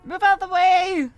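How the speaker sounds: loud angry yell; shouting. Acted. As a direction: yell it at the crowd. Game character yelling in traffic.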